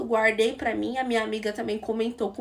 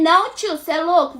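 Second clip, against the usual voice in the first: mock loud protest; 4 semitones higher, 8 dB louder than usual. Guy insists on walking her home.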